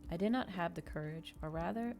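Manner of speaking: ordinary delivery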